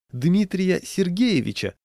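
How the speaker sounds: slow, high-pitched surprised question; astonished. A narrator's reading. Patrolman recognises the walker's doctor, astonished. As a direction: stretch the name out in amazed recognition.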